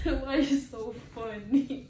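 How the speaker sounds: slow, laughing, tearful; laughing through tears. Real, not acted. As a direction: laugh while still crying.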